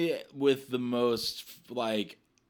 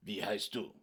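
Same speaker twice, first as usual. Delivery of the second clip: harsh German; 3 semitones lower, 8 dB quieter than usual. Retold or imitated. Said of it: "it was very, like".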